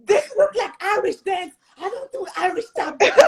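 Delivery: high, amused mock exclamation; incredulous teacher. Teacher in a Harlem workshop reacting to a student's step, high and amused. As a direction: exclaim in comic disbelief. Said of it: "I'm like".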